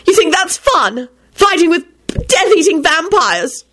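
high, slow scolding reproach; upset scolding. A narrator's reading. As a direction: scold them for treating danger as fun. Elizabeth upset at them.

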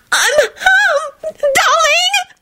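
very high ecstatic shriek. A narrator's reading. Loretta greeting Quentin, very high ecstatic shriek. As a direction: squeal it with wild joy. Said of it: "she shrieked ecstatically".

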